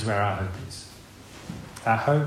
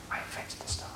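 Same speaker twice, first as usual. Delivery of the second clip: whispered God; 15 dB quieter than usual. Retold or imitated. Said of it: "God would say".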